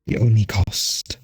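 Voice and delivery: slow, tempting otherworldly voice; swarm queen voice. Acted. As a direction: offer dark power, coaxing.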